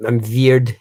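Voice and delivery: put-on drawn-out Dutch-accented joke; mock Dutch accent. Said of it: "I have a Dutch accent".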